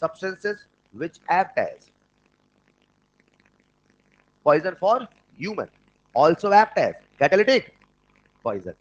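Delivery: slow dictation. Shown as a demonstration. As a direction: build up to catalytic. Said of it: "आपको मिल सकता है".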